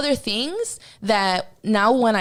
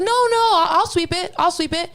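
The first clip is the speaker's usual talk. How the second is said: insistent stylist; 8 semitones higher, 3 dB louder than usual. Retold or imitated. Stylist insisting, high pitched.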